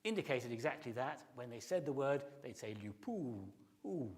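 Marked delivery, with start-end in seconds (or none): 2.7-4.1 "lupu" slowed, final oo gliding up then down; circumflex up-and-down. Shown as a demonstration. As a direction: draw out the oo of "lupu" with a falling swoop. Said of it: "They would use that up and down sound".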